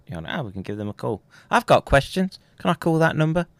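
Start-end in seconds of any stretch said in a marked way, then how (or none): 1.5-3.5 excited. Real, not acted. Eager jump in pitch and volume.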